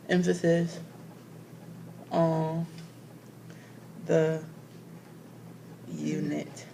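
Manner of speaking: word by word, long pauses between words; halting self-dictation, one word at a time; writing while speaking. Real, not acted. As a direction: say each word slowly as you write it down. Writing down words while saying them.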